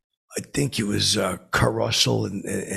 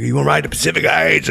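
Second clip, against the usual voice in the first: loud higher call, punching Heights; calling out again; 7 semitones higher, 10 dB louder, faster than usual. Retold or imitated. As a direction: holler the joke louder the second time. Calling out from car.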